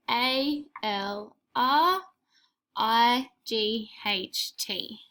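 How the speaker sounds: letters named slowly one at a time; slow spelling aloud. Shown as a demonstration. Teacher spelling letter names for writing, slow. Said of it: "say the letter names as you write them".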